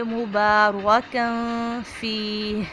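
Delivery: मुबारकन फीह very slow, a heavy रा and a breathy हा after फीह; slow model reading. Shown as a demonstration. Teacher models मुबारकन फीह, slow with a breathy end. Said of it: "फीह जब पढ़ेंगे तो इसके last पे भी हा की साउंड आएगी".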